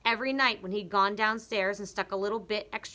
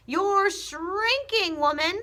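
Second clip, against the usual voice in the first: very high, loud, very slow sneer; husband taunting his wife; taunting Mr Twit; 11 semitones higher, 7 dB louder, slower than usual. Acted. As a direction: stretch "shrinking" cruelly.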